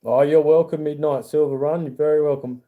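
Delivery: ordinary talk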